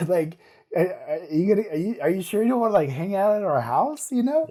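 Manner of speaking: hammed-up needy plea, climbing in pitch; playful pleading. Dad coaxing his teenager to stay home, pleading.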